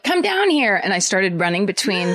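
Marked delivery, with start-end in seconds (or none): 0.0-0.8 calling out. Retold or imitated. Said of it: "my friends were like".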